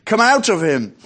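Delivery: stern command. A narrator's reading. Jesus ordering the evil spirit out, sternly. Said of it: "said Jesus sternly".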